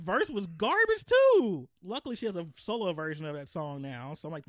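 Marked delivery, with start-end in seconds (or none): 0.5-1.7 loud, high exclamation; emphatic shout. Real, not acted. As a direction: punch it out. Outburst on garbage verse.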